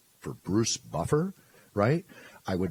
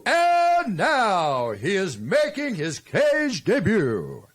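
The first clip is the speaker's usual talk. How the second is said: announcer call; 9 semitones higher, 4 dB louder, much slower than usual. Shown as a demonstration. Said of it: "you say what I tell you".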